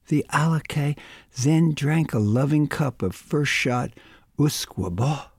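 usual speaking voice

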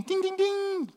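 high sing-song ding noises; imitated phone ding. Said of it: "my phone's dinging".

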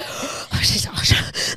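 mock huffing and puffing; huffy puffing. Imitating partner in an ice cream huff, puffed gasps. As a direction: imitate someone gasping in a huff. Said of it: "getting really huffy and puffy".

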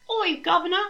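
put-on squeaky high Cockney child voice; mock Cockney girl. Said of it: "I'm not gonna do that anymore".

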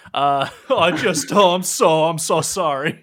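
loud, flustered, stumbling apology; nervous flop sweat. Acted. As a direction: blurt an apology in a hammed-up panic. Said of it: "he has a terrible flop sweat".

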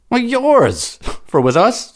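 high, loud hearty soldier voice; jovial Gaul. Acted. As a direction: boom out a merry barbarian laugh-line. Jovial Gaul explains custom.

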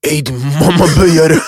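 loud, high, slow cursing; hammed-up outraged friend; mock angry insult. Friend confronting him at the apartment, mock angry insult.

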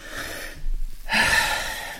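long soft unvoiced sigh; heavy sigh. Real, not acted. Host after the call about the grave, heavy sigh. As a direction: breathe out the dismay.

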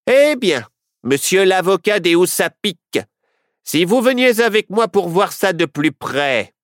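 high, loud voice of a blustering sheriff. A narrator's reading. Sheriff character voice.

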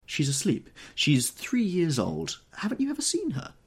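high, airy society lady; lightly voiced Daisy. A narrator's reading. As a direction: float the words up lightly.

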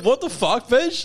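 high, incredulous mock outrage; hammed-up indignant challenge; outraged comeback. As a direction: spit back a comic insult, voice climbing. Acting out the drive-through quarrel.

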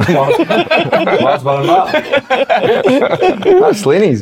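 laughing through the words; giggling as the joke lands. Real, not acted. Mates joking about dessert, laughing.

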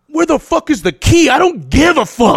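loud, high frantic yell; frantic demand. Retold or imitated. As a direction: demand the key in a rage. Retold frantic demand for key.

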